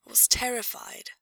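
whispered, for his ears only; hushed confession. Acted. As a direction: breathe it so no one else hears. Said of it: "her voice pitched so only he could hear her".

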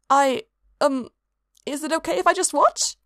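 high, timid squeaky ask; squeaked. A narrator's reading. Nervous cultist asks to watch, squeaking. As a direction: squeak it out shyly. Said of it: "squeaked out the fourth cultist".

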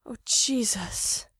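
slow, breathy whispered moan; drawn out on an unvoiced breath. A narrator's reading. Mikey moaning to Gerard, slow breathy whisper. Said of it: "Mikey moans".

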